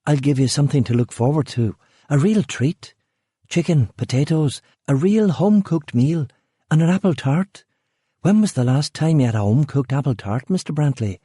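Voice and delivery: high, bright hostess pitch; forced cheer. A narrator's reading. Rachel tempting Eric with dinner, forced high cheer. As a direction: oversell it with false brightness.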